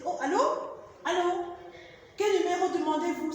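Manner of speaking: acted phone answer. Shown as a demonstration.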